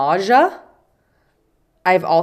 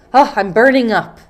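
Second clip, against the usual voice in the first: louder, higher overdramatic complaint; dramatic; 5 semitones higher, 7 dB louder, faster than usual. Shown as a demonstration. Teacher acting out a feverish patient for learners, dramatic. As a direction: play up the fever with loud drama. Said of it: "if you're being very dramatic".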